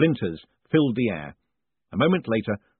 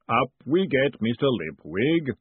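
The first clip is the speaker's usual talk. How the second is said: booming golem voice; 4 semitones higher, slower than usual. Acted. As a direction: boom it out slowly. Golem speaks again.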